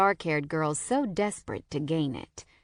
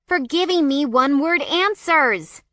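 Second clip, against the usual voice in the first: loud, high sharp snap; snapping; 8 semitones higher, 7 dB louder than usual. A narrator's reading. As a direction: scold her friend sharply. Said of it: "she snaps".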